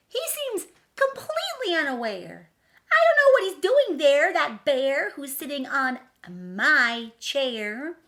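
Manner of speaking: high, loud, sing-song rhyming read; dramatic storybook reading. A narrator's reading. Reading the picture book to children, dramatically. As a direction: read the rhyme big for kids.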